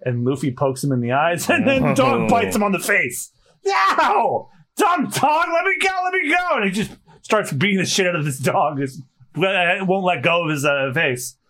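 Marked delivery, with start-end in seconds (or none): 3.7-6.5 very high, frantic yelp; hammed-up high-pitched distress; panicked protest. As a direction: struggle to break free as you cry out. Hero bitten by dog, struggling.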